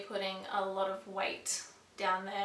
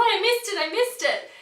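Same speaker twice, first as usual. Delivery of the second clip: high, loud panicked repeat; frantic; 11 semitones higher, 11 dB louder than usual. Retold or imitated. Mother at the scan, frantic about missing it. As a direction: blurt it twice in a rush. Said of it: "I'm like".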